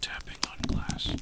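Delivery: ASMR whisper. Acted. ASMR streamer to viewers about space, whispering. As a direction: whisper gently to soothe the listener.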